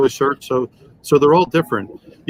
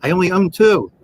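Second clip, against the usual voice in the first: retold upset; 6 semitones higher, 3 dB louder than usual. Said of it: "Now I'm really upset".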